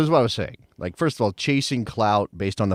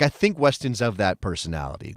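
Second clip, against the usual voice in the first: normal talking voice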